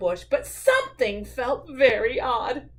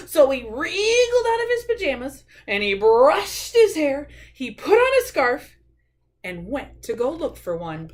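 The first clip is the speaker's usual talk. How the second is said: put-on children's story narration; 3 semitones higher, 4 dB louder than usual. A narrator's reading. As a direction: read it to small children, stretching key words. Reads a picture book aloud.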